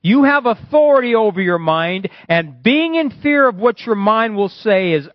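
ringing, stretched-out sermon delivery; prophetic preaching. Real, not acted. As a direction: proclaim it with full authority. Declaims prophecy at full force.

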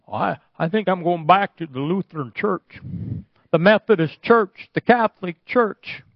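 high, mock-alarmed voice; scared-off churchgoer. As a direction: play someone backing out of the service.

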